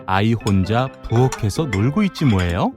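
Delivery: ordinary delivery, nothing marked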